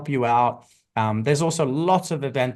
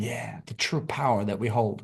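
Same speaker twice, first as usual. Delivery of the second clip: whisper; 4 semitones lower, 5 dB quieter than usual. Real, not acted. Guest's reverent aside about power.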